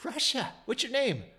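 high-pitched retold astonishment; excited surprise. Retelling his reply to the Russian stranger, excited surprise. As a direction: jump up in pitch, delighted discovery.

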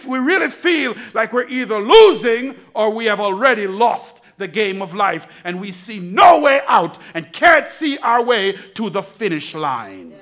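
loud, rising preacher's call; shouting preacher, pleading with the church; preaching at full force. Acted. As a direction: drive it home to the congregation.

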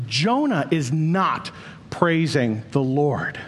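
ordinary delivery

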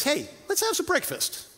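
lightly voiced Jesus calling them to eat; a friendly, upbeat call to breakfast; cheerful invitation. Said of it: "Jesus says".

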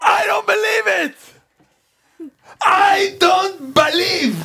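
high shouted disbelief; excited shouting. Real, not acted. Contestant guesses the answer.

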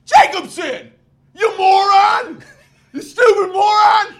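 high, loud hammed-up screaming; mock furious coach. Coach berating a kid on the field, mock furious scream.

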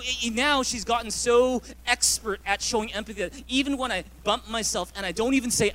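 usual speaking voice

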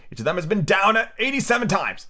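high, strained report; choking voice. A narrator's reading. Hatta reporting on the fight, high choked voice. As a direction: speak as if swallowing bread. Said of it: "he said in a choking voice".

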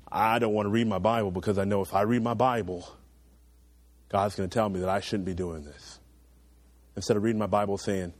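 ordinary talk, nothing marked

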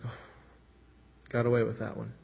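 a touch of smug relief, half to himself; relieved aside. Retold or imitated. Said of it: "he's like".

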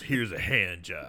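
low, slow, soft pun delivery; mock villain one-liner. Co-host quotes the killer gnome's pun, low mock one-liner. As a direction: drop the voice and drag out the one-liner.

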